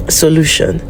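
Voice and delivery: ordinary talk, nothing marked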